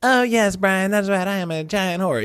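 high silly voice for the pet; squeaky voiced-over animal reply; Sasha. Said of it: "Isn't that right, Sasha?".

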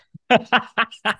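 loud burst of laughter. Real, not acted. Laughing about showering with the medal. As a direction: laugh at yourself.

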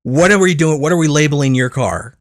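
a hammed-up busy valet asking; retelling the valet's questions, a bit pushy; imitating the valet. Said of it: "He just wants to know".

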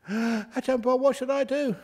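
gasp, then high alarmed cry; mock distressed plea. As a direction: imitate a panicking mother, pitched high. Retells a distressed mother's plea.

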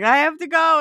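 high, urgent; urgent plea. Retold or imitated. Quoting Megan rushing in, high urgent plea. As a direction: blurt it in a panic. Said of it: "Melissa McCarthy coming in and being like".

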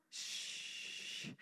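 one long soft shush; drawn-out soothing shush; gentle hushing. Acted. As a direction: hush slowly and very quietly. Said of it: "hearing the Lord going".

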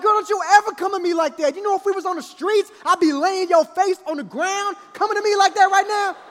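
loud, high-pitched threatening rant; furious tirade. Retold or imitated. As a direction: tear into the daughter, hammed-up rage. Retold father threatening daughter.

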